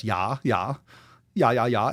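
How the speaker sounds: mock German "ja, ja"; German accent. Host showing yes in German, mock German accent.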